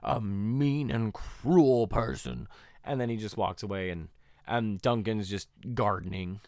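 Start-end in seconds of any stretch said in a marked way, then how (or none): 0.0-2.5 drawn-out, slow villain line, lightly voiced; slow mock villain. Gardening villain dismissing Jack, slow and smug. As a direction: savor each word like a smug villain. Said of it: "he's just like".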